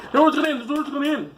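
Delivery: high-pitched doomsday cry, repeated; silly high prophet voice; mock doomsayer. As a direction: imitate a panicky end-times prophet. Mimics doomsday preachers.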